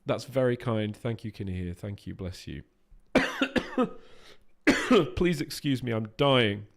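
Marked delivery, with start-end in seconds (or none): 1.0-2.6 low, soft mumbled thanks; quiet aside thanks. Real, not acted. Streamer quietly thanking the same donor again, offhand. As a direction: drop the voice and tuck the thanks away.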